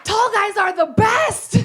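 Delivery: high, loud, laughing and slow; gleeful shout through a laugh; excited shout. Real, not acted. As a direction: belt it out with glee. Comic punch shouted with laughter.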